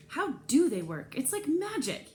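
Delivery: high, bright mock wonder on magic; joking. A narrator's reading. Trey jokes to the narrator about magnets, mock amazed. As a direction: play it up like a magic trick.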